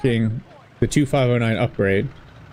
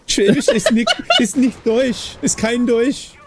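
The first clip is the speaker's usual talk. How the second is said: laughing German; 9 semitones higher than usual. Retold or imitated. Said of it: "Bane of Fire says".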